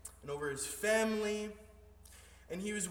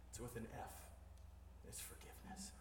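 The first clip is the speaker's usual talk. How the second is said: soft whisper, no voice; whispered answer; 6 semitones lower, 16 dB quieter, faster than usual. Acted. Pastor answers his own riddle to the church in a whisper. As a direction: breathe the answer out.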